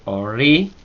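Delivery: "ori" rising hard on the final i; strong final accent. Shown as a demonstration. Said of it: "acento fuerte".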